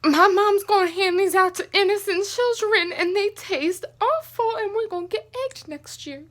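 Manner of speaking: high, loud outburst that softens toward the end; distressed protest. Real, not acted. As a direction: wail in outrage, then let it drop off. Protesting about awful candy.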